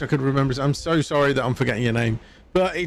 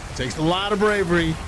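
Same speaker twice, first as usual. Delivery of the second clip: higher-pitched mock politician's voice; put-on presidential impression, pitched up; Trump; 5 semitones higher than usual. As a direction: impersonate a boastful American politician. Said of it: "Sound a bit like Trump now".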